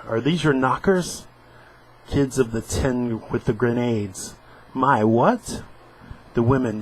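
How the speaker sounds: news and sitcom lines read aloud; comic panels read with voices; dramatic reading. Acted. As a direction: switch between anchor and comedy.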